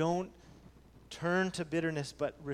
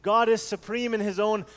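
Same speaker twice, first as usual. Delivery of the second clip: louder fervent pulpit delivery; preaching at full force; 4 semitones higher, 7 dB louder than usual. Acted. Pastor urging the church that God is supreme, fervent preaching. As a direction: drive the point home to the congregation.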